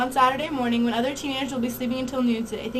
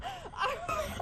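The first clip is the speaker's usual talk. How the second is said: crying laugh; 10 dB quieter than usual. Real, not acted. Prank revealed, upset laughter.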